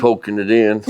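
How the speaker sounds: normal talking voice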